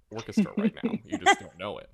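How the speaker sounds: high, loud singsong retort; playful defiant boast. Acted. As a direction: crow it loudly, up high. Comeback to those who dismiss intern.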